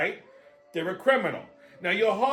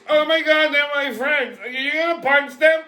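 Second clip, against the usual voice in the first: put-on much higher, startled girly voice; girl in the bar; 8 semitones higher than usual. Comic retelling a girl at the bar, in a high girly voice. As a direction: squeak it out like a scared young woman. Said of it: "this girl turns and goes".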